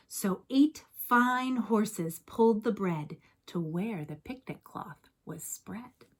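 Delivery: ordinary delivery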